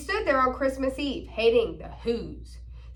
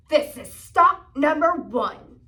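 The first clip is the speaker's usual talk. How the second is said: ordinary delivery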